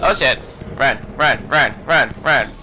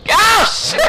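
panicked shout. Real, not acted. Player yelling in alarm at the enemy. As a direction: yell it in alarm. Enemy jumps him again.

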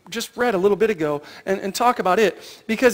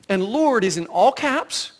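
ordinary talk

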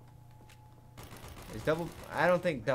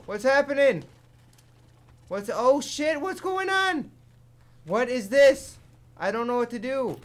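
high, loud frantic questions; lost and frightened, voice up; real alarm mid-game; panicked; 10 semitones higher, 9 dB louder than usual. Real, not acted. Panicking in zombie attack.

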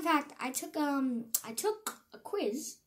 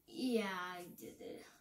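ordinary talk

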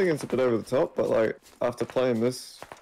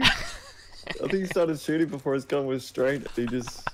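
ordinary delivery

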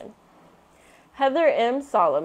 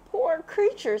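higher small cub voice; Baby Bear pity; 10 semitones higher than usual. A narrator's reading. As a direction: pity her like a little cub.

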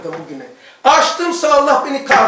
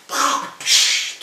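spitting sounds. Retold or imitated. Imitating spitting in the toilet.

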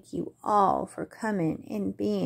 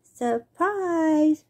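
bright, high, louder shout; spring out and surprise them; playful shout; 8 semitones higher, 5 dB louder than usual. Acted. Young possum jumping out from behind Ma, shouting. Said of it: "shouted a large young possum".

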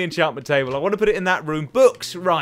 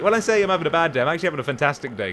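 ordinary talk, nothing marked

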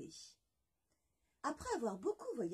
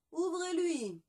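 loud, higher royal order; king's command; 7 semitones higher, 7 dB louder, slower than usual. Acted. Le roi ordonne à ses courtisans, voix forte. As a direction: bark it as the amused king. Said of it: "répondit-il".

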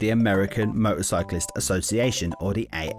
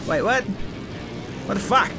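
surprised; 7 semitones higher than usual. Real, not acted. Sudden bike explosion in combat.

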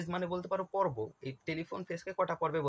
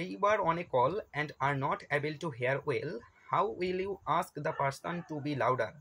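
slow question read; 3 dB louder, much slower than usual. Shown as a demonstration. Reading exam question aloud.

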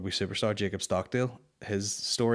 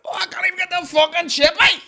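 high, loud whining shout; mimicking the man at the barrier; 10 dB louder than usual. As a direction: moan through the gap in the police line.

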